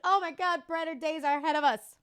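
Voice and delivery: thrilled the sun is back; excited. Retold or imitated. Recalling seeing the morning sun, excited and relieved.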